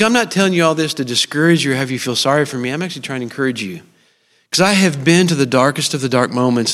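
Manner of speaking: normal talking voice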